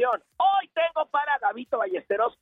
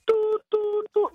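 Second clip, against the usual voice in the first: high tú tú call; 9 semitones higher, much slower than usual. Pretend. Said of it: "yo que siempre digo el tú".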